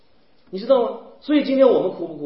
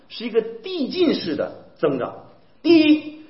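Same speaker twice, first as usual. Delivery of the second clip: normal talking voice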